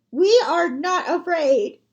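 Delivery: slow, loud storybook scream; mock scream. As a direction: yell it out, pretending to be brave. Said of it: "screamed Toad and Frog".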